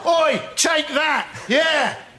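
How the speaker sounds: hammed-up street aggression; mock rioter's shout. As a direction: shout it like throwing a bottle. Acting a rioter throwing milk.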